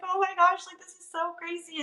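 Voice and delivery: high-pitched, moved and overwhelmed; retold teary amazement. Listener recalls crying at teacher's faith in him, teary amazement. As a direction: recall being choked up, voice lifted high. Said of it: "And I cried".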